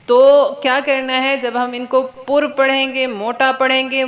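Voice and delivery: ordinary talk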